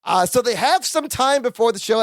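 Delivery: ordinary delivery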